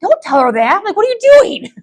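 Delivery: high, loud mock panic; alarmed high protest. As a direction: squeal it out in alarm. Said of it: "So I was like".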